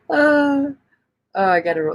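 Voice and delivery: usual speaking voice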